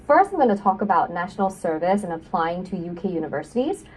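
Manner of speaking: ordinary talk, nothing marked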